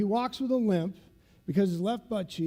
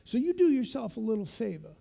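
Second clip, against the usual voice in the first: ordinary talk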